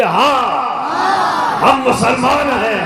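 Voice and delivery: high, slow proud shout of faith; bold declaration. Shown as a demonstration. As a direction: proclaim it boldly, without shame. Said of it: "دبنگئی سے کہیے".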